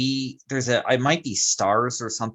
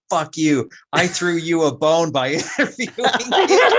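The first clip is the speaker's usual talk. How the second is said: louder, higher mock rant, breaking into laughter; retold furious outburst; 6 semitones higher, 5 dB louder than usual. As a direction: imitate someone losing it, voice climbing. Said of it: "the interviewer loses his mind".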